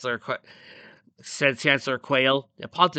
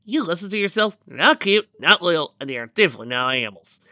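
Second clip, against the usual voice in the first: mildly heated retort, punching each denial; touch of irritation, insistent; a little angry; 4 semitones higher, 6 dB louder than usual. A narrator's reading. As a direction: argue back firmly, a bit loud. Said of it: "getting a little angry".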